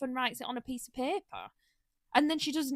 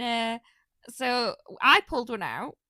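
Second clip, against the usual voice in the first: ordinary delivery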